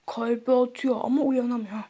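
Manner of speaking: quiet bedside worry; low hushed nurse. Acted. Nurse about unconscious patient.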